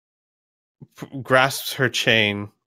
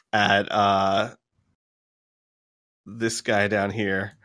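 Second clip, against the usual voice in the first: usual speaking voice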